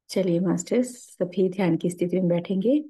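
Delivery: calm, unhurried meditation guide with long pauses; guided meditation. Acted. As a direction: lead the masters gently into stillness and prayer.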